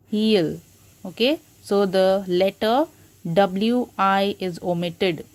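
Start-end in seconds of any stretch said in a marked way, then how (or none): 0.1-0.6 "Heal" as he will run together; contracted form. Shown as a demonstration. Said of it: "the short form of he will is".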